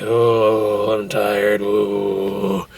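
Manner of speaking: low, weary groaning; mock exhausted moan, dragged out low; tired Louis. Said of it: "you can tell Louis getting tired".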